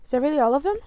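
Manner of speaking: ordinary talk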